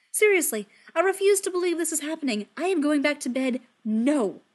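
loud exasperated complaining; fed up and loud, ranting. Acted.

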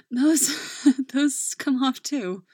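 laughing out the words. Acted. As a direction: say it through a breathy laugh. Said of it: "she laughs, rasping".

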